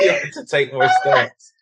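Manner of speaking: loud laugh through the punchline. Real, not acted. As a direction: finish the tease laughing. Teasing friend, laughing loudly.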